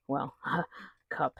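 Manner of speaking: soft amused laugh through words. Real, not acted. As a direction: chuckle as you say it. Laughs at the small cup.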